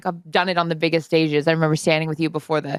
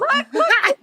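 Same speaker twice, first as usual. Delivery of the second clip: excited disbelief. Retold or imitated. Fan reacting to her friend on TV, squealing excitedly. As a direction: squeal with delight at seeing her on TV. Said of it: "I'm, like, all excited".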